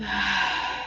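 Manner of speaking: slow audible breath out through the mouth; long exhale. Real, not acted. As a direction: let the air go with a sigh. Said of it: "out of the mouth".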